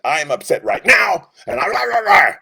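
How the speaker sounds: high, loud mock tantrum with a cry in it; mock upset outburst. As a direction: rant like a hothead in the moment. Esau voiced living in moment.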